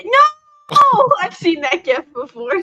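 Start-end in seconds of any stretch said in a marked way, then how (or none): 0.0-1.1 high, loud, drawn-out protest; a real outburst of mock-outraged refusal; horrified shout. Real, not acted. Fan protesting as a friend ruins a beloved show, horrified shout.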